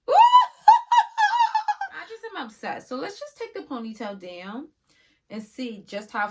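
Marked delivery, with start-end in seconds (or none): 0.1-1.9 loud high whoop into a big laugh; excited whoop and laugh. Real, not acted. Vlogger whooping at her own wig look, loud and laughing. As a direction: let out a thrilled whoop and cackle.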